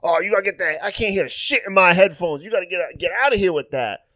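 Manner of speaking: high, loud impression of an annoyed player; irate teammate imitation. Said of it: "The guy's like".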